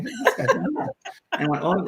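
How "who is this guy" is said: high, puzzled audience voice; lightly voiced confused audience member; mock bewildered. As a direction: imitate a baffled onlooker.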